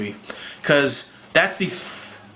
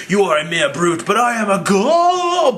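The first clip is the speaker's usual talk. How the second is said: haughty god; 6 semitones higher than usual. Retold or imitated. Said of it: "talking down the Hulk like".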